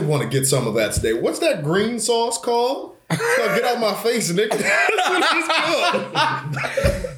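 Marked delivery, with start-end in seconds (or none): 1.1-7.2 high, slow joke with a laugh; laughing punchline. Retold or imitated. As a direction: deliver the gag through laughter. Mock boss question, laughing punchline.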